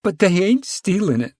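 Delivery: high, defensive plea; defensive high whine. A narrator's reading. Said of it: "She defended them".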